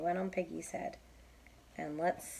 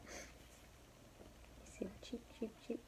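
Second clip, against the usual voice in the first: soft, slow whispered cheeps; hushed whisper; 4 semitones higher, 16 dB quieter, much slower than usual. Real, not acted. Reader whispers to child.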